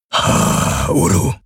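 low grumble, lightly voiced; grumbled. A narrator's reading. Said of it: "brummte Hawks".

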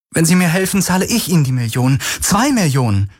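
high-pitched, urgent offer; desperate bargaining. A narrator's reading. Kirai offers money for help, desperate bargaining.

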